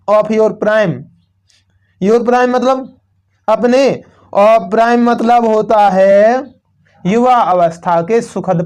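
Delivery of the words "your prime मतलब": normal talking voice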